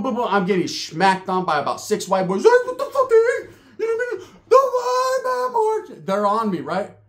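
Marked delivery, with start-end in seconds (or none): 2.4-6.0 high, loud, laughing yell; hammed-up shouting, laughing through it; mock attackers yelling. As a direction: yell it like a gang jumping someone.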